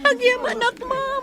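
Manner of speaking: high, crying thank-you; tearful thanks. Acted. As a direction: thank her through sobs. Mother thanking through tears. (agsangsangit nga agyaman)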